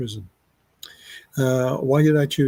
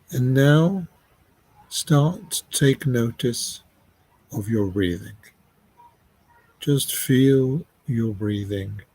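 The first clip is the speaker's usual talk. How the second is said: guided meditation. Real, not acted. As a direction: lead them unhurriedly, phrase by phrase, leaving space between. Leads listeners into guided meditation.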